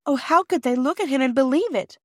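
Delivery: high-pitched, distressed outcry; pained protest, voice lifted high; indignant cry. A narrator's reading. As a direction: cry out in disbelief as the upset child. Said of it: "cried Bobby".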